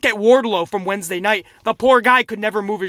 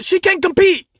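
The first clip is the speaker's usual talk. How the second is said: higher, mock-official call; imitated referee ruling; 8 semitones higher, 4 dB louder than usual. Reviewer voicing the referee changing his mind, imitated ruling. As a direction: call it out like a flustered ref. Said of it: "So the ref was like".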